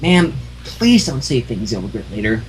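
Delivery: quiet, tight warning; annoyed cashier. A narrator's reading. Cashier warns rude old customer, quietly annoyed. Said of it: "the register pony now was annoyed".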